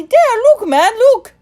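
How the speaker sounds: very high, loud scornful pointing. A narrator's reading. Timothy impatient with the boy, scornful. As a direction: scoff as you point it out. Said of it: "Timothy answered scornfully".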